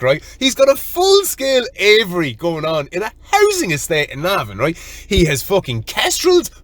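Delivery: high-pitched, laughing astonishment; amazed. Real, not acted. Telling the lads about a housing-estate aviary, amazed. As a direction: reel off each discovery in escalating disbelief.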